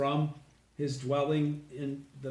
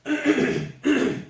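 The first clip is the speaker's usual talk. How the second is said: strained, throat-clogged words; congested voice; 11 semitones higher, 9 dB louder, much slower than usual. Real, not acted. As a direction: push through a congested throat. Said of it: "I don't know why I'm getting congested".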